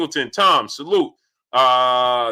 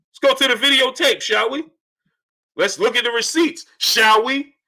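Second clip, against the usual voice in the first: smug sarcastic exposé; 6 semitones higher than usual. Acted. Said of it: "let's just go to the videotape".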